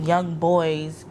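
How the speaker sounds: ordinary talk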